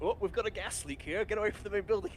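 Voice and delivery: pretending to be staff on the radio; faked calm radio warning. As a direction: play the villain bluffing over the radio. Whittaker's fake radio warning.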